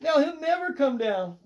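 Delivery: high, plaintive wail; lamenting. A narrator's reading. Cat's owner looking up the tree, lamenting. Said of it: "Mister Sims lamented".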